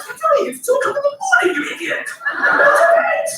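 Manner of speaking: mock-furious dad at two in the morning; exasperated shout. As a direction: yell at the kid who woke you. Father scolding son again.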